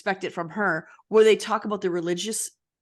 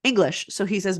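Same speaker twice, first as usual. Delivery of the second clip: ordinary talk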